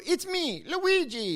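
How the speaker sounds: high, hammed-up Italian accent. Mocking Mario Brothers style, high Italian accent. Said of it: "Or you do the Italian".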